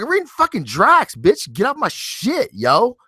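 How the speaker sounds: high, cocky mock retort; mock tough comeback. Husband pretend-snapping at wife, cocky high retort. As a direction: act like a tough guy snapping back. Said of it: "And I'm like".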